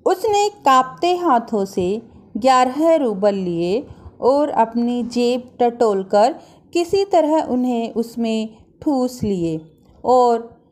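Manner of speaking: usual speaking voice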